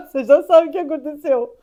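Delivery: cracking up before the punchline; laughing. Real, not acted. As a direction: talk loudly through real laughter.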